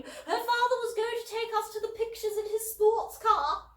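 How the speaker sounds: put-on high, loud, sulky posh girl; pouting teen. A narrator's reading. Elder sister sulking to Dad about missing Megan's weekend, high and pouting. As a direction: whine it with a pout.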